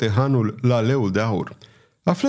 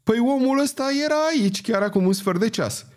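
a much higher, animated outburst; excited exclamation; 10 semitones higher, faster than usual. A narrator's reading. Innkeeper exclaims to Pardaion on recognising the man. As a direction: blurt it out, amazed. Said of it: "încât hangița exclamă".

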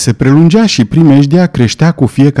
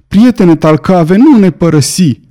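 pleading cry; 6 semitones higher than usual. A narrator's reading. Boy begging his friend not to leave, pleading cry. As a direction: plead in a raised pitch without really shouting. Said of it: "strigă Robert, aruncându-se la picioarele lui".

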